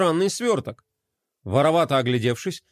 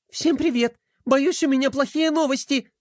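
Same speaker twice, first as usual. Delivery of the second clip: higher, rushed princess chatter; rattled-off news; 10 semitones higher than usual. A narrator's reading. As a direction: blurt out the bad news. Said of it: "затараторила она". (высоким голосом, скороговоркой)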